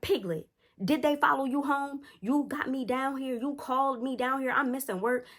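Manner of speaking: high-pitched, fed-up scolding; exasperated parent. Retold or imitated. Voices annoyed mom scolding child.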